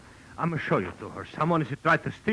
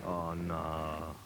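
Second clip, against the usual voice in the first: dismayed; 9 semitones lower, 9 dB quieter, slower than usual. Acted. Gunshot, owner dismayed.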